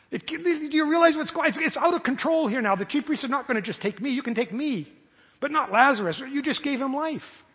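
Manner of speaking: high, urgent pleading; pressing God in alarm; agitated plea. Pretend. Jesus pleading with the Father for Lazarus, high and urgent.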